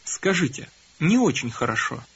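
normal talking voice

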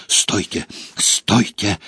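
gasping cry, out of breath; breathless shout. A narrator's reading. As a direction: call out while fighting for air. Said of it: "задыхаясь, кричал Гарри".

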